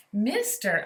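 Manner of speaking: Mister called out loud and high; sudden call out. Acted. Lorax popping out of the stump to the Onceler, loud call. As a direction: call out to get his attention. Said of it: "I said with a sawdusty sneeze".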